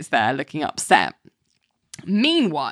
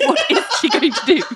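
high, fast, laughing question; 11 semitones higher, 3 dB louder, faster than usual. Real, not acted.

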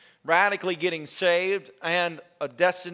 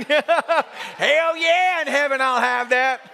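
high, raised voice breaking into laughter; loud, pitched-up joking whoop; laughing mock cheer; 6 semitones higher, 4 dB louder, much slower than usual. Joking cheer while cracking up.

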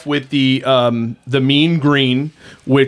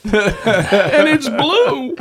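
laughing; 10 semitones higher, much slower than usual. Real, not acted. Joking about the drink.

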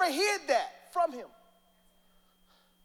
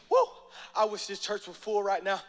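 ordinary delivery

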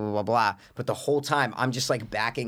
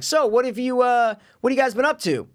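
friendly call; 11 semitones higher than usual. Retold or imitated. Asking the kids.

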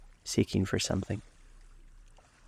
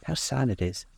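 higher-pitched, falling lament; a touch of wistful sadness, pitched up; murmured lament; 4 semitones higher than usual. Acted. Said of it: "murmured Dorian Gray".

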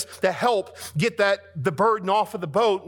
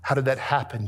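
usual speaking voice

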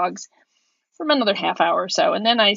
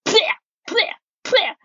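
imitated spitting; 11 semitones higher than usual. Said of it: "starts going".

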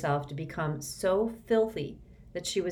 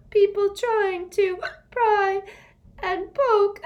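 high, loud, gasping elf; a wounded elf between sobs; sobbing elf; 9 dB louder than usual. Acted. Winky sobbing at Harry, gasping.

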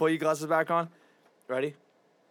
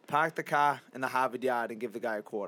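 Boston accent with dropped R on car and yard; 2 semitones lower than usual. Shown as a demonstration. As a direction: say car as cah, Boston style. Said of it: "say that again".

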